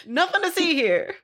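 high, hammy villain chuckle; mustache twirling villain. Acted. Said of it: "twirl my mustache".

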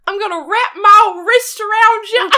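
put-on old prospector. Old man voice game continues.

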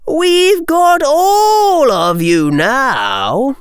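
high, annoyed taunt. A narrator's reading. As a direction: raise the pitch and drag out the ending in irritation. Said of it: "said Lee in an annoyed tone".

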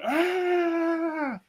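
high piercing battle yell from the goblin horde; a shrill rallying "Ah!" as swords go up; goblin war cry. Acted. Goblin warband cheering their leader on, raising swords.

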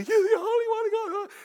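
very high, loud panicked cry; terrified demon shriek. Acted. Demons recognize Jesus in the church, high terrified shriek. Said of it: "and they're terrified".

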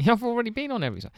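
high, fast mock boast; cheeky high-pitched quip. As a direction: rattle it off like a smug spy. Mock quote of the spy.

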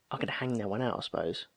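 ordinary delivery

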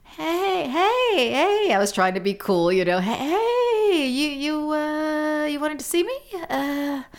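high, jittery voice, trying to sound cool; a nervous young employee forcing a casual greeting to the gruff boss; stammering and pitched high with nerves; shaky nervous voice. Retold or imitated. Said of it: "in a real shaky voice".

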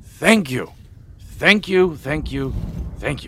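high, loud, tumbling thanks; gushing thanks. Acted. The mayor thanks the heroes in high, loud relief. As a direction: overflow with relief.

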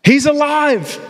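high, bright exultant cry; joyful shout. Retold or imitated. Mary shouting to the disciples, high and exultant. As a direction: burst out with the good news. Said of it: "she shouted".

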